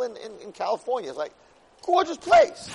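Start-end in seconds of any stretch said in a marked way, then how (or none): 1.8-2.7 high, loud exclamation of awe; gushing exclamation. Retold or imitated. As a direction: gush about it at a high pitch, loudly. Retelling gushing over the place.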